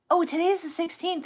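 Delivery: normal talking voice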